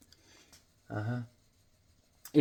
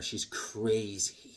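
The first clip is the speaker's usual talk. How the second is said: spooky; 7 semitones lower, 10 dB quieter, much slower than usual. Acted.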